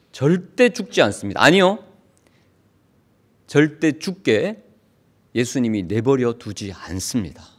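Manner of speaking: ordinary talk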